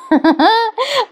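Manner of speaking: a high burst of giggling at the scene; real laugh. Real, not acted. Reviewer laughing at the couple's comic scene, high giggle.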